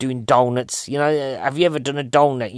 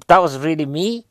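normal talking voice

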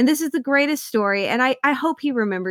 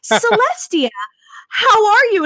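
much higher, louder, beaming greeting; delighted greeting; 11 semitones higher, 5 dB louder than usual. Retold or imitated. Mom voicing actor greeting her daughter, high and delighted. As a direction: call the kid's name with pure delight.

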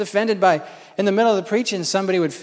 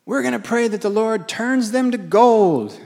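higher, slow, grand declaration; mock churchgoers; 6 semitones higher, 4 dB louder than usual. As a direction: proclaim it like an over-earnest believer. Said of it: "They're like".

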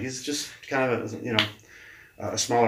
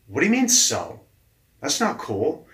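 higher, louder indignation; mock-offended to the boss; retold indignant whine; indignant protest; 6 semitones higher, 6 dB louder than usual.

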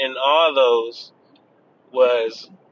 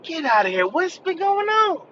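high, surprised and slow; mock surprise; slower than usual. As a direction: act shocked, pitching way up. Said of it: "I'm like".

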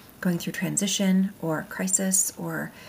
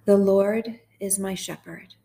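slow, measured psalm reading; guided meditation; slower than usual. Real, not acted. Host guiding sad listeners through Psalm twenty-three, slow meditation. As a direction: read each phrase slowly, leaving room to repeat it.

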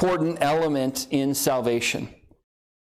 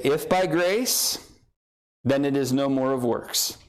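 slow reading, phrase by phrase; slower reading. Shown as a demonstration. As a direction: spell it out slowly with a pause between clauses. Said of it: "I'll read it a little slower".

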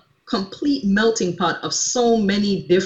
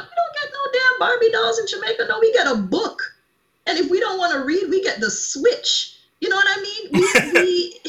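animated high pitch; 12 semitones higher than usual. Real, not acted. Jamaican woman telling the host about home, animated and high. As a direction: voice jumps way higher, fast and emphatic.

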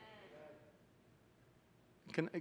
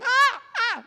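loud high yelps of panic; short, high mock screams; imitated screaming; 12 dB louder than usual. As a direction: mimic passengers screaming in turbulence. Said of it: "people were actually screaming".

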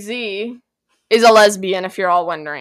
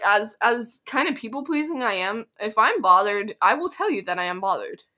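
ordinary talk, nothing marked

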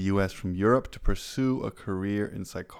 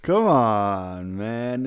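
put-on Jamaican accent; 5 dB louder, much slower than usual. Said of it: "I'm working on my Jamaican accent".

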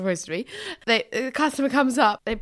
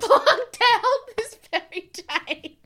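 mock dramatic; 12 semitones higher, much slower than usual. Dramatic repeat of tweezer story.

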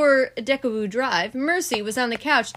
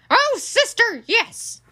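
high, loud mock-echo of the plea; stubborn Eugenia; 13 semitones higher, 5 dB louder, slower than usual. Eugenia answering Baby's plea, loud and mocking. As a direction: throw her words back at her.

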